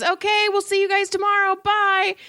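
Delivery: high, quick sing-out farewell; cheery dad goodbye. Retold or imitated. Dad behind the kid calling bye to preschool people, cheery high voice. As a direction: call it out brightly like a parent waving goodbye. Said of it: "the dad behind him goes".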